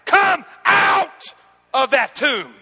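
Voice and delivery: loud, high, booming command; commanding shout. Acted. Preacher as Jesus, shouting Lazarus out of the tomb. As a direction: roar it like a king at the tomb. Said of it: "He stood there like a man".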